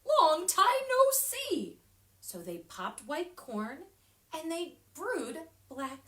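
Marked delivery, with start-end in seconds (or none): 0.1-1.8 high, loud cheerful hare voice; high hare greeting. Acted. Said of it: "says Hare".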